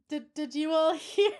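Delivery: loud question broken by a laugh; amused, laughing mid-sentence. Real, not acted. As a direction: ask it through a laugh, voice lifted. Laughs as the fix works.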